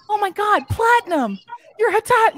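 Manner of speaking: high, loud panicked cry; alarmed client. Retold or imitated. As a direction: shout the alarm about the fire.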